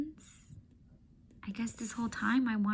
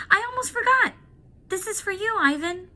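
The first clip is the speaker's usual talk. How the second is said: much higher and louder, bursting with an idea; excited girl; 12 semitones higher, 7 dB louder, faster than usual. Acted. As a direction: pitch the plan eagerly to dad. Julia excited.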